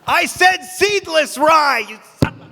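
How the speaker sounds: an outraged customer berating the baker; mock angry customer.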